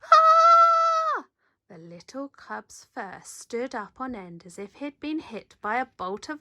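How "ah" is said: very high loud startled shriek; terrified scream. Acted. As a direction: scream in sudden fright. Cub gets scared himself.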